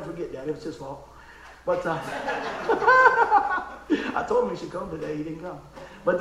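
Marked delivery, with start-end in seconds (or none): 2.0-4.1 sudden high burst of laughter. Real, not acted. Pastor laughing at the kindergarten memory, loud burst.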